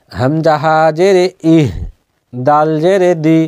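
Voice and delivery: normal talking voice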